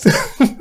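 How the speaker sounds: high, loud nervous burst of laughter; startled laugh. Real, not acted. Player just got scared, startled laughing. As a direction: laugh it off right after a fright.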